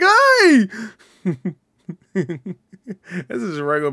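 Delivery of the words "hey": jolly pumpkin greeting. Retold or imitated. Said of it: "He's like".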